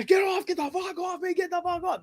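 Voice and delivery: high mock yell, held back in volume; retold struggling shout. Man fighting off two cops, mock yelling. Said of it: "And this guy".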